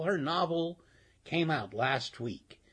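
ordinary talk, nothing marked

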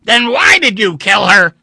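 very loud, high furious demand; angry shout. A narrator's reading. Vandeleur shouting at the android, angry shout. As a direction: bellow the question. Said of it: "Vandeleur shouted".

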